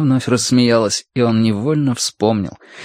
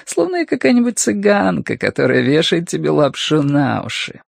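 teasing, higher female voice; flirtatious woman caller; 3 semitones higher than usual. Acted. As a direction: draw out the opening, then mock him playfully. Sonya teases Gurney on phone.

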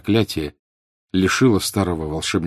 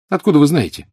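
sharp, higher and louder blurt; quick childish retort; 8 semitones higher, 6 dB louder, faster than usual. A narrator's reading. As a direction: snap back like a child. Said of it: "мгновенно спросил Малфой".

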